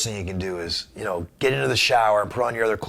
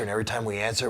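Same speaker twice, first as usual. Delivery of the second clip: ordinary talk, nothing marked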